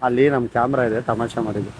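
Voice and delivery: calm him down gently, it was a joke; reassuring. Real, not acted. Prankster soothing the crying man, revealing the camera, reassuring.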